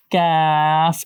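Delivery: "কাফ" from a k on the palate, long a; letter-name demo. Shown as a demonstration. Teacher names the letter shown, drawn-out pronunciation. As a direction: stretch the vowel in "কাফ", ending on f. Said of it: "ক এর মতো করে".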